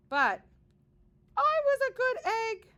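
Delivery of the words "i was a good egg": high, loud proud cry; proud exclamation. A narrator's reading. Egg telling the reader, high proud cry. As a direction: insist you are good, high and loud.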